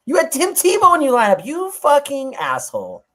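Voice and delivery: high, loud mock outrage at a friend. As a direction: roast him with fake fury, high and loud. Ribbing friend over fantasy draft.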